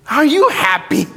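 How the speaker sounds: a lightly mocking replay of "Are you happy?"; mocking his own question. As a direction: parrot "Are you happy?" back, teasing yourself. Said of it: "I wanna slap myself".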